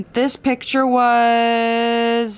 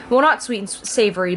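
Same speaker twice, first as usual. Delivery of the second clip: usual speaking voice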